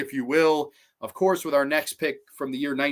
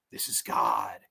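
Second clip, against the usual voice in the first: very low, quieter menacing voice; imitated killer's reply; 6 dB quieter, slower than usual. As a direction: drop deep for the villain's answer. Freddy's reply acted out.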